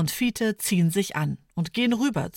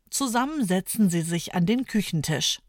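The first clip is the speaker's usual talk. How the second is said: ordinary delivery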